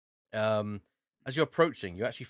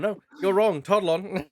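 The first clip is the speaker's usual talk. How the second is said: mock dismissive; 9 semitones higher, 7 dB louder than usual. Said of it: "as you're basically saying".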